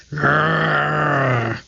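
long rolling animal growl; sustained throaty "grrr"; imitated growl. A narrator's reading. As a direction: growl like a big cat. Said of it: "There was a terrible growl".

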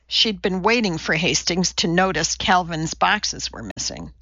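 plain reading voice

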